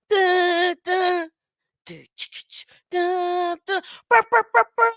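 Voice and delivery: high horn blasts and burrs by mouth; dancehall horn noise. Acted. Host clowning as DJ, mock dancehall airhorns. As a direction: imitate airhorns and record scratches, high-pitched.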